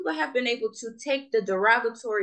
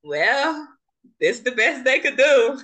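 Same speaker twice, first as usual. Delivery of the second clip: louder, dry Jamaican-tinged dismissal; unimpressed reaction; 5 dB louder, slower than usual. Retold or imitated. Recalling first hearing the actor's accent, dry louder dismissal. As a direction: sound let down and unimpressed. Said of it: "I said".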